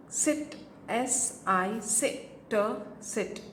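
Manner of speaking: very slow, letters then word; drawn-out phonics blending; slow sounding out. Shown as a demonstration. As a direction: blend it slowly for a child. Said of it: "इस तरह से अगर आप करवाएंगे".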